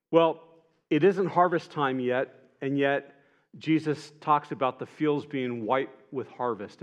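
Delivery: normal talking voice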